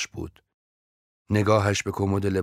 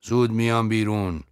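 raised voice from the bedroom; called out; 4 semitones higher, 5 dB louder than usual. A narrator's reading. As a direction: call loudly from the next room. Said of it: "از آنجا فریاد زد". (صدای بلند از اتاق خواب)

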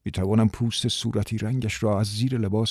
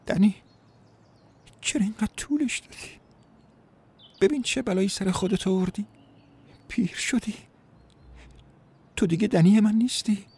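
tearful woman; 12 semitones higher than usual. Acted. Diane weeps over how long Danny took, high and tearful. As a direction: reproach through tears. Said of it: "اشک از چشمانش سرازیر شد".

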